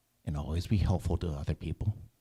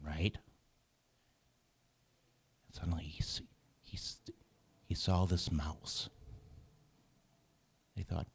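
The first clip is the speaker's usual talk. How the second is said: storytelling hush; 5 dB quieter than usual. A narrator's reading. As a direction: draw the children in with a hushed tale. Story narration to children.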